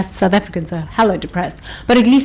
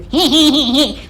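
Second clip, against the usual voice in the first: little tittering giggle, higher; demo giggle; 5 semitones higher than usual. Shown as a demonstration. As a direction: giggle as a demonstration. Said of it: "laugh like this".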